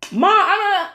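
high, loud, put-on cry to mom; hammed-up high-pitched protest; mock protesting son. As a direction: imitate a son protesting loudly to his mother. Son protesting to his mother.

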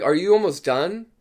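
high, rising question to the dentist; acted polite check, lifting at the end; polite asking. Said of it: "And I asked him politely".

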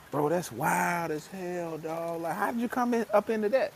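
high, slowed astonishment; amazed friend. Retold or imitated. Buddy reacting to the booming business, high and amazed. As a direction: marvel at it like an impressed buddy.

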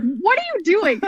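high, startled cop shouting at her; cop yelling. Retold or imitated. Police officer tackling a daughter, startled shout. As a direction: bark it like a cop grabbing a runner.

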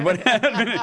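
high, laughing disbelief; mock surprise. Real, not acted. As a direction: act amazed while laughing. Mock astonishment at good day.

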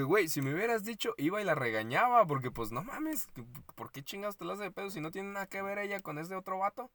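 telling a friend how she told off the co-worker, indignant; retold outrage. Retelling her own outraged reply.

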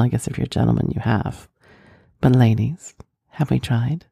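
ordinary delivery

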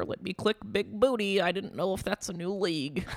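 high, playful imitation of a friend; Sarah impression. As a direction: chirp it like a clueless friend. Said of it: "nice Sarah impression".

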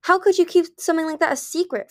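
high, loud indignant demand. A narrator's reading. Fearless challenging Moon over her secret, demanding. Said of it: "Fearless demanded".

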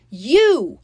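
high, loud accusing yell. A narrator's reading. Girl accusing her stepsister, yelling. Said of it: "I yelled at her".

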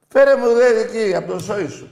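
very loud high taunt; mocking shout. Real, not acted. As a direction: scream the jab. Mocking the caller loudly.

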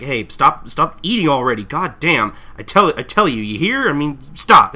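acted mad customer. Customer tells clerks to stop eating, loud acted anger. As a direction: scold them with mock fury. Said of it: "he's just really mad".

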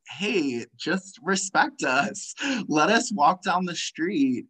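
plead, voice lifted, for basic respect. Retold or imitated. Marchers appealing to be let walk down the street, pleading. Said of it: "they're, like, pleading".

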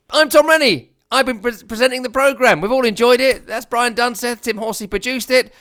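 high-pitched, upbeat announcer-style outro; podcast sign-off. Real, not acted. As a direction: lift the voice high for the closing credits.

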